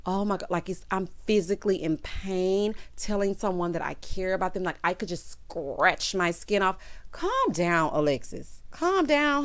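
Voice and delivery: ordinary talk, nothing marked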